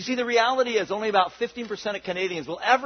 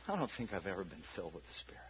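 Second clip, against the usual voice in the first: hushed confession; 13 semitones lower, 18 dB quieter, faster than usual. Retold or imitated. Pastor's confession retold.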